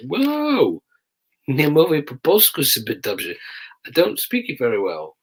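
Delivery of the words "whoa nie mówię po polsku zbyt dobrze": high startled whoa then Polish; overwhelmed whoa. Retold or imitated. Sergeant swamped by rapid Polish, startled high protest. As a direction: thrown by the flood, pleading in Polish.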